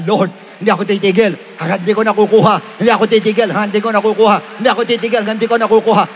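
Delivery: loud, insistent, building repetition. Shown as a demonstration. Preacher shows a believer refusing to stop praying, loud. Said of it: "taasan mo pa volume mo".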